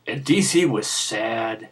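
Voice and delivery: slow, soft, low, mock-mournful; mock sad. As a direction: droop the voice like a sad picture book. Storybook: DC happy vs sad.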